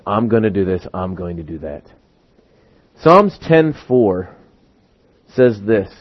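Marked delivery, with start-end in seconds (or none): 0.1-1.8 low, soft, self-satisfied murmur; self-assured person. Acted. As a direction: drop right down, trailing softer. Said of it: "listen to the way this person is talking".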